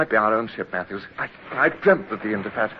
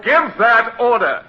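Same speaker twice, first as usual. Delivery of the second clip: loud, higher, slow; slow loud command; 10 semitones higher, 6 dB louder, slower than usual. Acted. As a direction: spell out each word as an ultimatum. Holding fisherman at gunpoint.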